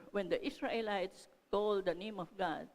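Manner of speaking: ordinary talk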